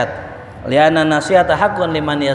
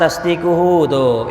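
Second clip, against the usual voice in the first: ordinary talk, nothing marked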